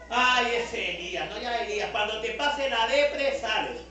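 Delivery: mock-tender God teasing a depressed prophet; mock indulgent God. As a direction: drawl it out like a doting father teasing Elijah. God voiced indulgently about Elijah.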